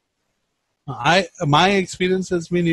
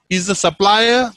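normal talking voice

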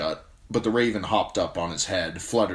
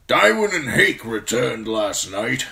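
put-on loud, raised old commander's voice, reporting; old bear commander; 5 semitones higher, 7 dB louder than usual. A narrator's reading. Commander tells the young man of the returned search party, loudly. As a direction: give the report as a forceful old officer. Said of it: "the old bear said".

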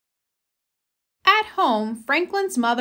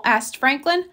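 ordinary delivery, nothing marked

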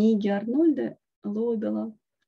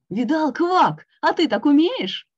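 higher, louder bragging taunt; boasting; 6 semitones higher, 7 dB louder than usual. A narrator's reading. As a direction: show off to your little friend. Toad boasting to friend.

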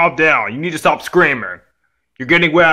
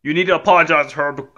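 ordinary talk

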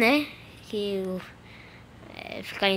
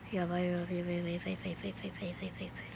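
fading whisper; 5 semitones lower, 16 dB quieter than usual. Real, not acted. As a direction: chant dropping to a whisper. Tense countdown fading.

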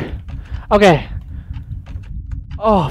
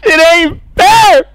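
whiny; 7 semitones higher, 3 dB louder than usual. Real, not acted. Caught in the game.

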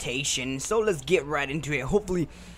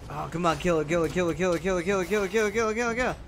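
psyching myself up; 3 semitones higher, much faster than usual. Real, not acted. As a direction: pump yourself up, chanting. Chanting at the enemy to die.

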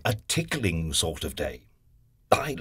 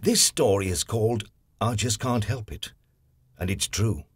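plain reading voice

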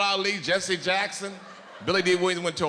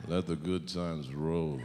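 smooth low Billy Dee voice; 11 semitones lower, 6 dB quieter, slower than usual. Acted. Voicing Billy Dee Williams.

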